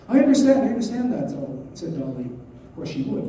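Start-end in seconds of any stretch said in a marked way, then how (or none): 0.1-1.3 high, loud, hurried-in reply; acted emphatic agreement; agitated understanding. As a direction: cut in with feeling, pitched up. Dolly agitatedly answers Karenin.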